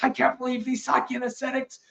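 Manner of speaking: hammed-up outrage at the monks; mock furious husband ranting; angry husband. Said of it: "the husband got really angry and he's like".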